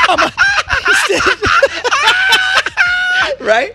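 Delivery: high shrieking laughter; laughing hard. Real, not acted. Storyteller cracking up telling his friend about the slap, shrieking laughter. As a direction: laugh helplessly through the words. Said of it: "Crispy chicken slap?".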